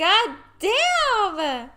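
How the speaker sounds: drawn-out amazed God damn; excited exclamation. Real, not acted. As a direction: marvel at it, long and high. Echoing the exclamation.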